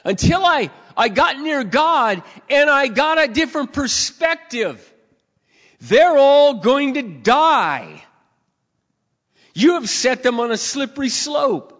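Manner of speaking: loud, high, very slow proclamation; preacher proclaiming the wicked's end, loud, high and slow; full-force preaching. Acted. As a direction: proclaim their fate, slow and heavy. Preaching climax.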